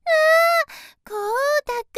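high, loud delighted hail; bright cry of recognition, then the name; calling out. Acted. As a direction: call to a friend you just spotted. Spots Kota and calls out.

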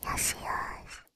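soft unvoiced soothing whisper; ASMR whisper. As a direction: whisper comfort close to the ear. Whispered comforting roleplay.

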